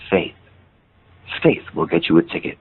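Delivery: low, gentle retold oracle, pausing after the first word. Stoic stranger answering what gets you a ticket, very gently. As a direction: impart it gently, low and measured. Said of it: "gently, he goes".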